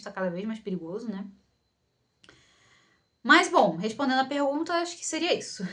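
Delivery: ordinary talk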